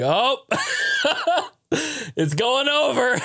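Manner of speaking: laughing while imitating a panicked mom; mock panic broken by giggles; mom's panic through laughter. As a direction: squeeze the words out between laughs. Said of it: "all I hear is my mom saying".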